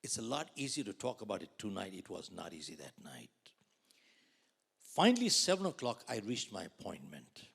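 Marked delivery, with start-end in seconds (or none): 1.9-3.3 whispered "It was not easy that night"; hushed confession. Real, not acted. Speaker recalling his darkest night, soft whisper. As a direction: let it fall to a whisper.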